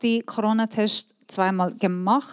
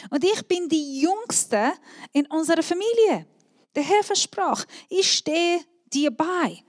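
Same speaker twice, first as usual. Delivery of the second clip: Gideon's objection, pitched higher; pleading Gideon; 6 semitones higher than usual. Acted. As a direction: plead like the smallest, youngest son.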